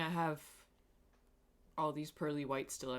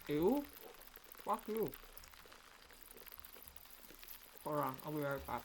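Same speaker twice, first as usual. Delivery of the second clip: talking with a toothbrush in mouth; while brushing teeth; 3 semitones higher, 4 dB quieter than usual. Real, not acted. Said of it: "I'm brushing my teeth".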